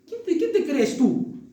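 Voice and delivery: slow, amused mocking question; sarcastic, laughing. Preacher voicing Paul mocking proud Corinthians, laughing sarcasm. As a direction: tease them with a laugh. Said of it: "Pablo está hablando sarcásticamente".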